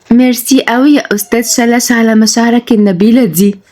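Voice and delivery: lightly voiced woman, touched by the flowers; tender thanks. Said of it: "وهي بتقول برقة".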